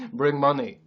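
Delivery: loud fake Czech accent; mock Czech accent. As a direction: punch it out in a put-on Czech accent. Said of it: "what accent is Czech".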